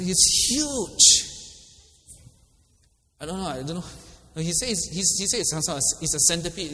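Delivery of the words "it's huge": retold alarm. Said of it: "And I said".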